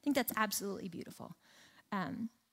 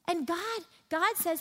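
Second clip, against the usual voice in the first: normal talking voice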